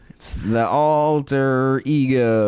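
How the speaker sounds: "Alter" and "Ego" stretched very long; slow, elongated "Alter Ego"; drawn-out spelling. Pretend. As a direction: drag out the vowels and Rs of "Alter Ego". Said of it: "tell us how many letters are in the first word".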